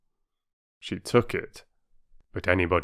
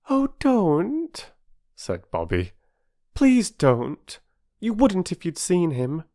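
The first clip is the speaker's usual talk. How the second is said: put-on higher, earnest pleading girl; 10 semitones higher than usual. A narrator's reading. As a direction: beg him not to laugh.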